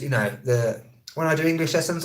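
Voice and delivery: ordinary talk, nothing marked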